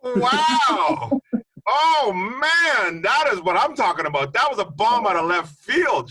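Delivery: thrilled, loud and high; excited. Real, not acted. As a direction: blown away by the answer. Host thrilled by surprise nickname.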